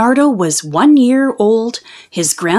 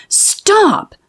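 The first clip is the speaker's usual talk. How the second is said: high, sharp stop; sharp teacher's stop; 12 semitones higher than usual. Acted. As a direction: cut the laughter off with one high word. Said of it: "said Missus Gomez".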